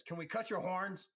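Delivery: a lightly voiced Kova asking; Kova tailor. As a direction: ask it bluntly as the tailor. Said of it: "one of them says".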